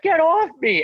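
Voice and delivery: loud, high-pitched yell; high mock yell. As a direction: yell while being tackled. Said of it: "And I'm just like".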